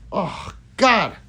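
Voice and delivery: sharp cry of real pain. Real, not acted. Streamer's swollen foot suddenly hurts, pained cry.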